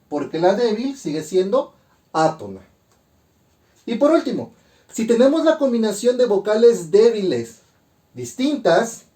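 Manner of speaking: ordinary delivery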